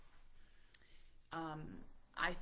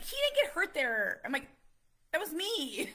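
much higher, louder amused protest; incredulous squeal; 11 semitones higher, 12 dB louder than usual. Retold or imitated. Laughing disbelief at son's answer.

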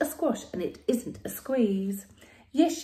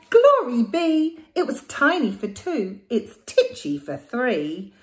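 loud cry; 4 semitones higher, 9 dB louder than usual. Acted. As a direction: cry out in dismay. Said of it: "The little old lady cried".

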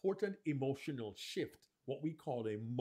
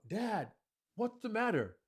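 put-on young son; 6 semitones higher than usual. Shown as a demonstration. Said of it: "You become the characters".

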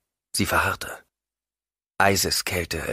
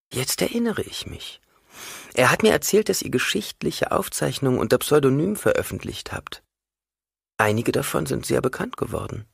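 put-on higher, lighter young woman's voice; Hwi's voice; 8 semitones higher than usual. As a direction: voice Hwi, respectful and curious. Hwi speaks to Leto.

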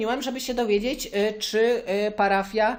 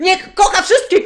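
outraged shout; 10 semitones higher, 10 dB louder than usual. Acted. Caller cuts in to argue.

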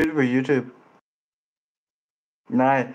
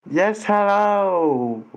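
drawn-out mock hello; 7 semitones higher, much slower than usual.

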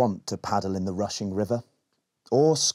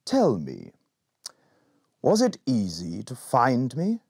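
slow wise lizard; slower than usual. Acted. Lizard questions Berry.

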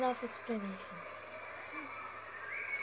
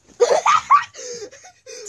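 high squealing laugh; being tickled; 13 semitones higher, 11 dB louder than usual. Real, not acted. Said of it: "Please don't tickle me hard".

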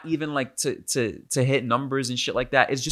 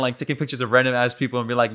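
usual speaking voice

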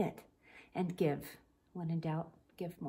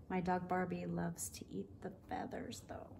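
whispered aside; 3 semitones lower, 6 dB quieter than usual. Real, not acted. Confiding aside about her dog.

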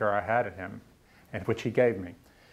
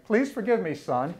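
mock pleading, pitched up; a hammed-up wished-for apology; lightly voiced father begging; imagined father; 8 semitones higher than usual. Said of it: "I kept waiting for him to say".

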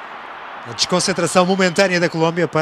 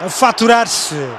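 raised, animated play-by-play; excited commentary; 7 semitones higher, slower than usual. Real, not acted. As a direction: keep the goal excitement going. Post-goal commentary.

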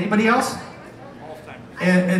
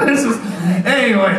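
ordinary delivery

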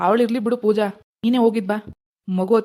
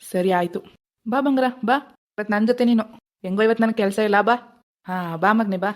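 usual speaking voice

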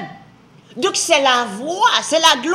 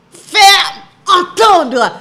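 shouted emphasis; 6 semitones higher, 8 dB louder, much slower than usual. Acted. Climax of the sermon build.

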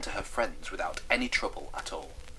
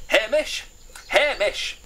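high, loud shout for a friend; hollering across a distance; calling out; 13 semitones higher, 8 dB louder, slower than usual. Acted. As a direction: yell the name to summon him. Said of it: "what's with all this screaming".

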